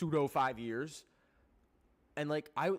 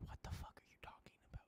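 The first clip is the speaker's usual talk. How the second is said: fast, breathy whisper of disbelief; incredulous whisper; 16 dB quieter, much faster than usual. Real, not acted. Vlogger reacting to the school ban in a whisper. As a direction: hiss it under your breath, fast. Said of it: "reacting to schools' reasoning".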